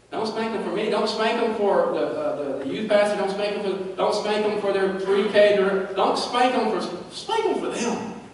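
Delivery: impassioned preacher build, climbing high on the last line; preaching at full force. Real, not acted. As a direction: drive it home, rising each time.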